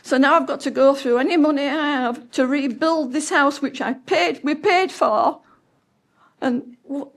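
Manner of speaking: normal talking voice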